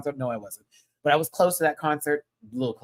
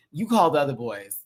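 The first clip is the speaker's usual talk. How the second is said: ordinary talk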